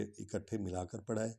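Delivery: ordinary delivery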